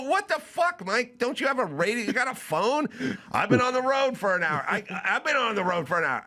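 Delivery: high, incredulous exasperation; exasperated outburst. Retold or imitated. Scolding Mike for not knowing, high incredulous outburst. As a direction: fire it off high in disbelief. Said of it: "I go".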